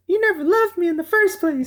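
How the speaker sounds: high, lightly voiced girlfriend; mock woman's voice. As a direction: imitate a hurt woman. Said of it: "the girl says".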